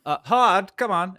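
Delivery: high, loud, impatient summons to the servant; bossy call. Acted. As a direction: call the minion over sharply. GM voices Mordain calling Hod.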